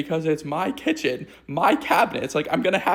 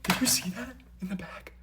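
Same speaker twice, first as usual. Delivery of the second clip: breathless whisper at the cabinet; 6 semitones higher, 8 dB quieter than usual. Real, not acted. Vlogger peeking into the cabinet at a hidden animal, whispering. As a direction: hush it so the creature won't hear.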